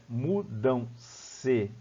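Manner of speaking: "mudam-se" very slowly as mu, dam, se; syllable by syllable. Shown as a demonstration. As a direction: separate every syllable of "mudam-se". Breaks word into syllables.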